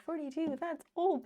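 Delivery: a touch of mock horror at the age; mock shock. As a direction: retell the old dismay with a lift. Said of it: "at first I was like".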